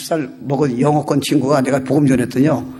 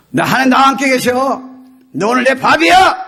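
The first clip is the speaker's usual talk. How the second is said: high, loud, boastful battle taunt; David; 8 semitones higher, 3 dB louder than usual. Acted. Young shepherd telling Goliath he is done for, bold taunt. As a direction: taunt the giant with rising confidence. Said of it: "딱 그러잖아요".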